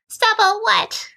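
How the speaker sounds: high incredulous Anna voice; reading Anna. Acted. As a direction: squeak out her confused question. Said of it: "I will read them".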